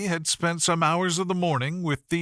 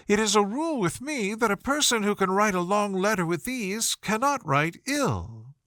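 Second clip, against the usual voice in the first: Miss Bingley admiring; 7 semitones higher than usual. A narrator's reading. Miss Bingley admiring Darcy's writing, cooing and high. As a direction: coo the compliment at him.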